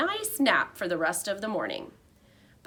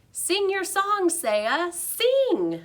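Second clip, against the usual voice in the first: high, louder squeaky urging; squeaking loudly; 11 semitones higher, 4 dB louder, much slower than usual. A narrator's reading. Hamster cheering the shy girl on from his cage. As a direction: squeak it out as loud as you can. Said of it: "I squeaked out as loudly as I could".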